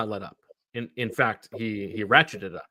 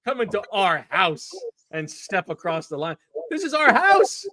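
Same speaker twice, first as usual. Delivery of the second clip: much higher, slow, hammed-up outrage; mock indignant bark; 8 semitones higher, slower than usual. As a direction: play the outraged owner defending his ground. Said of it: "Howie Roseman barking at those two guys".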